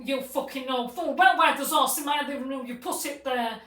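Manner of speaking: loud mock tirade; acted angry accusation. Retold angry accusation on phone.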